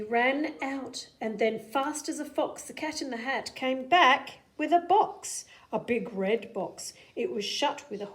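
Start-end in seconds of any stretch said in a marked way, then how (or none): none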